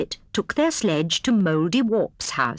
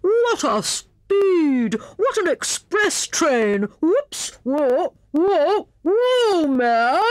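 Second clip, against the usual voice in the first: high, drawn-out thrilled exclamations; breathless cry; 9 semitones higher, 3 dB louder, much slower than usual. A narrator's reading. Hare on a sledge that wouldn't stop, breathless cry. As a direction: cry out on a runaway ride. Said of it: "cried Hare breathlessly".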